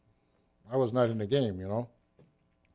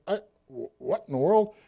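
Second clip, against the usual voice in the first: higher-pitched, halting disbelief; retold bewilderment; 6 semitones higher than usual. Young tanker engulfed in halon powder, bewildered. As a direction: stammer out a baffled reaction. Acts out bewildered thought while choking.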